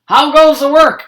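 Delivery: bossy farmer shouting from bed; lazy farmer calling. A narrator's reading. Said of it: "called the farmer".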